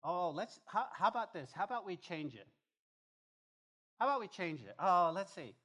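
loud, high, exaggerated musing; mocking suggestion. Preacher mimicking churches that rewrite scripture, mocking. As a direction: ham up a bright idea, loudly.

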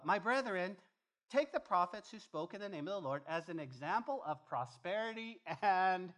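mock preaching. Preacher parodying churches that preach prosperity, mock proclamation. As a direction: proclaim the altered verse with exaggerated volume.

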